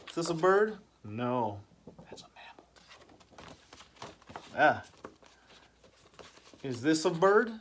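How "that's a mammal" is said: playful whispered aside. Acted. Teacher whispering the answer to the class. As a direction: whisper it like a secret.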